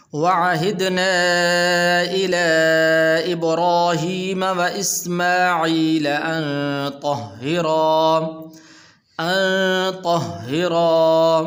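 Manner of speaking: slow sung tajweed recitation; Quran recitation. Shown as a demonstration. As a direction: chant each word long and melodic. (ترتیل کے ساتھ تلاوت)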